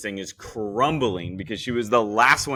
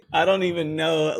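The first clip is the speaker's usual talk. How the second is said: said through laughter; laughing; 6 semitones higher than usual. Real, not acted. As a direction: talk while still laughing. Laughing at the silly cake.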